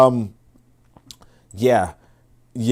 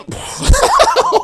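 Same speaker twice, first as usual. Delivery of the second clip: real laugh at the clip; high burst of laughter. Real, not acted. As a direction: break into laughter. Reacting to the video clip.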